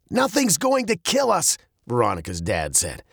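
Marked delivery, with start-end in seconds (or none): 0.1-1.6 high, emphatic dad voice; insistent dad. A narrator's reading. Dad counters the fear of dying, high and insistent. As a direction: insist firmly in a raised pitch.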